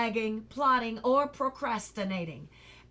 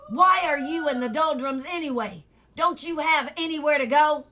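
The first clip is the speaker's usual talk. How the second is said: gruff watchdog; 5 semitones higher, 8 dB louder than usual. Acted. Watchdog questions Milo.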